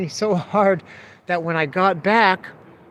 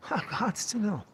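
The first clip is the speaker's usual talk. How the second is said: soft mock pleading; 4 semitones higher, 9 dB quieter than usual. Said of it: "Master manipulator".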